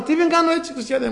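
high-pitched acted protest; indignant retort. Blind man answering those who hush him, indignant retort. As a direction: fire back, voice shooting up. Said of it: "μου λέει αυτός".

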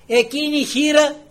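loud, high proclamation; impassioned preaching. Real, not acted. Preacher holds up the widow as example, impassioned. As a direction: proclaim her to the congregation.

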